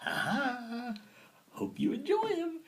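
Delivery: high, sneering mock voice to the enemy; mocking taunt. Germans taunting Russia as they hand over Lenin, high mocking voice. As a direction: taunt them like a smug schemer. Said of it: "and be like".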